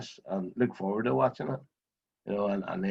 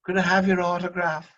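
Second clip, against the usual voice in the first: put-on higher, eager kid asking for an autograph; a boy's voice begging a rally driver for his autograph; imitating a young boy; 8 semitones higher, 4 dB louder than usual. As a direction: ask hopefully like a wee lad at the car window. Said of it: "He says".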